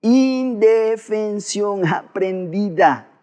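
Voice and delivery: very slow, drawn-out "Indefensión aprendida", syllable by syllable; exaggeratedly slow, even-pitched chant-along of "Indefensión aprendida"; repeat-along dictation. Shown as a demonstration. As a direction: stretch every syllable of "Indefensión aprendida" for listeners to join in. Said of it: "A ver, repetilo conmigo".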